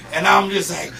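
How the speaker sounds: ordinary delivery, nothing marked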